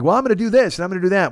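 high, hammed-up mock pledge; mock resolution voice. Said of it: "you're like".

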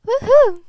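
very high, very loud celebratory whoop; mock whoop. Vlogger cheering herself after ranting, a very loud high whoop. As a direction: cheer yourself on at full blast.

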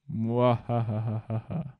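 slow, low evil laugh; mustache twirling villain. Pretend. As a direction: cackle like a cartoon villain. Villain laugh after threat. (risada maligna de vilão)

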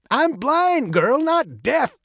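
put-on high, gravelly old man's voice; testy old man, high and gravelly. A narrator's reading. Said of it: "his voice as old and gravelly as his face".

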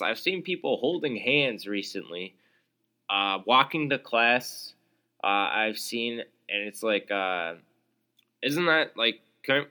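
ordinary delivery

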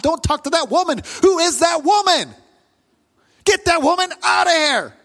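high-pitched, heated protest rising to yells; angry. Acted. Desperate father fending off crowd and woman delaying Jesus, angry. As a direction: demand it in angry, urgent outbursts. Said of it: "Jairus is just angry".

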